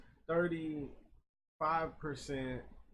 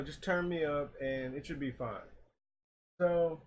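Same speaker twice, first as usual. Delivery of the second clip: ordinary delivery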